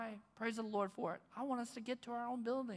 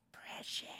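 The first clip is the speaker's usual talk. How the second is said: a breathy whispered Gollum hiss; lightly voiced Gollum whisper; Gollum impression; 4 dB quieter than usual. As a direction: hiss it like Gollum, half-hearted. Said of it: "I can't do the voice".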